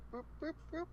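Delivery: soft, very high paddling sound effect; squeaky little boop noises; tiny high boops. Pretend. Imitates paddling along.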